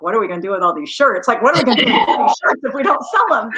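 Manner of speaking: fast, laughing mock panic; retold fret to husband, fast and laughing; laughing worry. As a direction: rattle off the worry while laughing at it. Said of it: "my husband and I are like".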